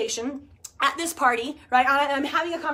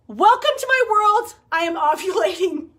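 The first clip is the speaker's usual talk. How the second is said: triumphant announcement; 6 semitones higher, 7 dB louder than usual. Pretend. Showing her viewers the solid happy face, mock-triumphant announcement. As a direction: announce it like a grand reveal.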